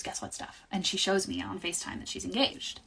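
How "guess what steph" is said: hushed teasing. Retold or imitated. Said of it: "and she goes".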